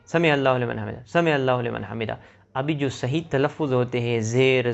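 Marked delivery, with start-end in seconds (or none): 0.1-2.2 سمع اللہ لمن حمیدہ hurried and slurred; rushed recitation. Shown as a demonstration. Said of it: "جلدی جلدی میں یہ پڑھتے ہیں". (سمع اللہ لمن حمیدہ جلدی میں غلط)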